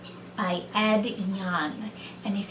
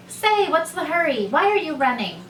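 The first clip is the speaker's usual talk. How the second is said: higher, lively questioning; curious rabbit; 6 semitones higher, faster than usual. A narrator's reading. Second rabbit asks the running rabbit, curious. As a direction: call to a passer-by, puzzled.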